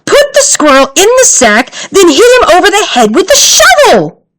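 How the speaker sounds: high, urgent order; mock command. A narrator's reading. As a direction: bark the instructions at him. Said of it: "shouted her mother".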